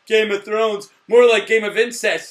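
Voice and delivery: mock announcement. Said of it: "and then I put on Facebook".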